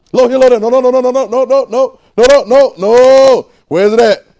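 full-force preaching. Acted. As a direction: cry out to the church.

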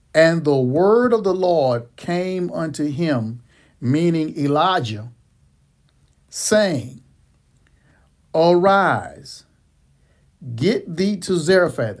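slow, deliberate scripture reading; reading slowly. Shown as a demonstration. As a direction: read each phrase slowly on purpose. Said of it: "we're gonna read this slowly".

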